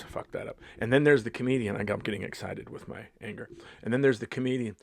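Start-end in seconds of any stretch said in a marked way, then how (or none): none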